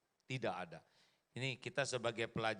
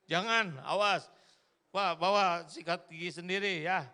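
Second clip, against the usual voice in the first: loud warning; 7 semitones higher, 8 dB louder than usual. Real, not acted. Preacher warns audience, joking.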